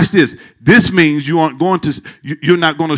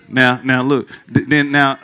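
normal talking voice

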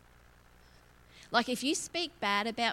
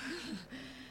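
breathless whispered giggle; a sheepish whispered chuckle; guilty whispered laugh; 12 dB quieter than usual. Real, not acted. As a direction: laugh it off under your breath. Awkward laugh at herself.

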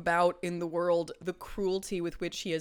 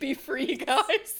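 laughing; 7 semitones higher, 6 dB louder, much slower than usual. Real, not acted. Freeing teddy bears, laughing.

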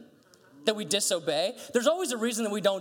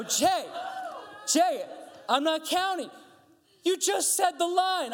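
exasperated parent, higher pitched; raised voice; 11 semitones higher, slower than usual. Retold or imitated. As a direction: scold the child with mounting insistence. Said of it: "I probably raised my voice a little bit".

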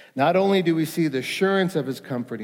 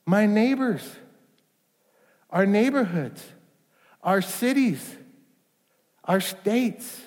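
higher, emphatic preaching; fervent preaching; 7 semitones higher than usual. Acted. Pastor marvelling at the Great Commission, fervent. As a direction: press each item of the list.

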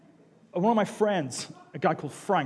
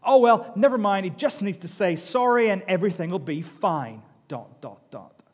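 sarcastic cynic; 3 semitones higher, 7 dB louder than usual. Retold or imitated. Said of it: "he said".

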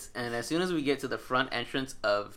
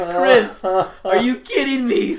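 high, loud, slow mock wail through laughter; laughing despair at a friend's bad luck; whining half-crying, half-laughing; exasperated laugh-cry; 9 semitones higher, 10 dB louder, slower than usual.

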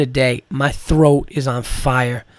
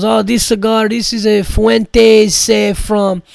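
put-on higher, louder proud Armenian accent; Armenian accent impression; 6 semitones higher, 4 dB louder, slower than usual. Comic imitating Hamo proudly showing off his huge cigar in an Armenian accent. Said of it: "imitating this guy".

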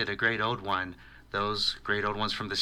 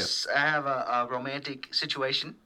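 put-on Southern accent; 7 semitones higher, 4 dB louder, faster than usual. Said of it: "I live down in Tennessee".

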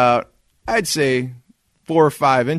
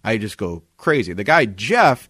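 ordinary talk, nothing marked

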